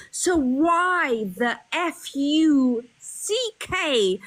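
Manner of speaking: each letter spelled out slowly and hard; spelled-out swear. Acted. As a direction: drag out F U C K letter by letter to stress it. Spells out the swear word angrily.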